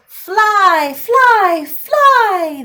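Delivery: clamor for it like greedy trolls. Acted. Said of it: "they clamored".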